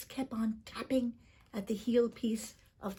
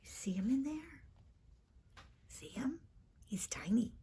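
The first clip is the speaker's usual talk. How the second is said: whisper; 3 semitones higher, 11 dB quieter than usual. A narrator's reading. Aside to listener, spotting leprechaun.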